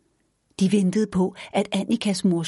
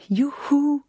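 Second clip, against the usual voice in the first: high, delighted whoop; joyful cheer; 8 semitones higher than usual. A narrator's reading. Annika cheers happily, turning somersaults. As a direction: cheer with pure glee. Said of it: "af glæde".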